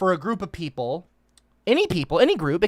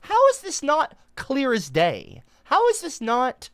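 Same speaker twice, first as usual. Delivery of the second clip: exasperated disbelief; 9 semitones higher than usual. Real, not acted. Exasperated rant about hypocrisy.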